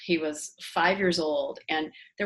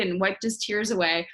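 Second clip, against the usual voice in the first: usual speaking voice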